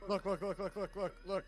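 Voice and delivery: high urgent repeats; frantic pointing it out; urgent panicked. Real, not acted. Spotting monster.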